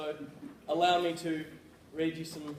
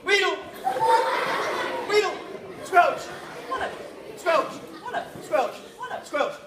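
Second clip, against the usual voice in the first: high, shouted onomatopoeia words; sound poetry; 12 semitones higher, 6 dB louder, much slower than usual. Acted. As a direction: shout each sound word, high and punchy. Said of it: "creating an onomatopoeia".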